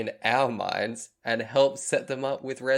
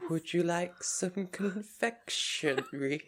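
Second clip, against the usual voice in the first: creepy whisper; 6 semitones higher, 6 dB quieter than usual. Retold or imitated. Creepy quote from salad fingers.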